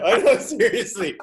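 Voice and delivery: laughing through the words. Real, not acted. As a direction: laugh while you say it. Laughing at his own story.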